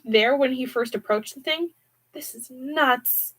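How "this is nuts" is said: lightly voiced Hudson. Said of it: "Hudson said".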